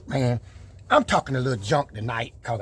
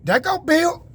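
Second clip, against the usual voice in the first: high, loud showman hype; hype intro; 12 semitones higher, 5 dB louder than usual. Real, not acted. Vlogger hyping his own truck video, loud and high. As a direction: announce yourself like a star entrance.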